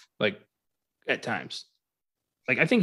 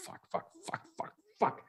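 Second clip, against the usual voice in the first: rapid whispered swearing; mock panic under the breath; panicked muttering; 13 dB quieter than usual. Bomber fretting over his ticking plan, frantic whisper.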